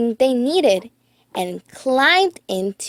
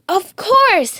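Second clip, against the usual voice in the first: Riley voice; 9 semitones higher, 4 dB louder than usual. A narrator's reading. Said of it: "said Riley".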